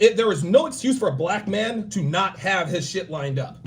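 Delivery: normal talking voice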